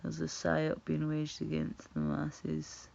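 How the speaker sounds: ordinary talk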